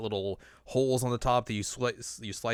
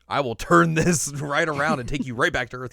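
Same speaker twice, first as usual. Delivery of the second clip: raised-voice parental warning, played for laughs; mock stern threat; 4 semitones higher, 7 dB louder than usual. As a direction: scold loudly, holding back a laugh. Whis scolds Goku like a parent.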